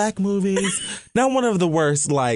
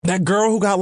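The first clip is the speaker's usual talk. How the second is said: normal talking voice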